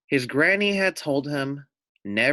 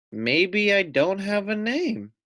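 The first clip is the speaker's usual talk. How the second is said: slow, higher little lamb voice; a hint of a wondering little lamb; small lamb voice; 7 semitones higher, slower than usual.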